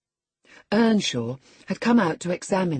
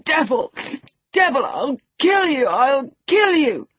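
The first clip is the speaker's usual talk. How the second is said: higher still, loud frantic shriek; shrieking curse; 8 semitones higher, 5 dB louder than usual. A narrator's reading. Linton cursing the locked door, frantic high shriek. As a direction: scream at the door in blind fury. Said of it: "He rather shrieked than said".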